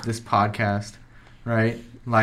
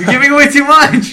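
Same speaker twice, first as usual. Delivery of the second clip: laughing shout; 14 semitones higher, 9 dB louder than usual. Real, not acted. Friends teasing, laughing shout.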